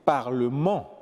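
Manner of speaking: "parlement" landing on its final syllable; accent sur la dernière syllabe. Shown as a demonstration. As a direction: stress the last syllable of "parlement". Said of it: "l'accent tombe à la dernière syllabe". (« parlement » accentué sur la finale)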